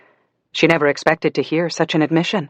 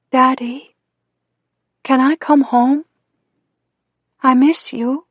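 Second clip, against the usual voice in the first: high, homesick little daughter; pleading childish voice; 10 semitones higher, 3 dB louder, slower than usual. A narrator's reading. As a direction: beg your father like a small child. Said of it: "Her childish voice through the speaker sounded so sad".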